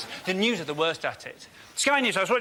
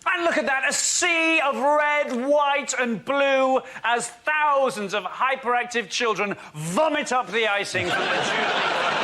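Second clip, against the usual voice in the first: higher, slow grand broadcast tone; pageant commentary; 5 semitones higher, slower than usual. Pretend. Comedian spoofing Jubilee coverage in grand commentary. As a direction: announce the pageant proudly.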